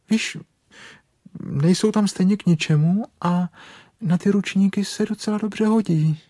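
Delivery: high-pitched, matter-of-fact rabbit voice; chatty rabbit. Acted. Rabbit wants bear's legs as towel rack, high chatty voice. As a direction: wheedle practically in a high character voice.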